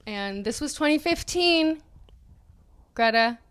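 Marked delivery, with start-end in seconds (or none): none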